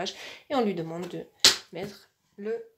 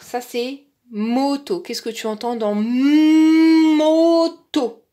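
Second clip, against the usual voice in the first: slow, louder, higher, stretching "moto"; exaggerated emphasis; 5 semitones higher, 10 dB louder, much slower than usual. Shown as a demonstration. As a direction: overarticulate "moto" for a child. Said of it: "donc on insiste grossièrement". (articulation exagérée et très lente de « moto »)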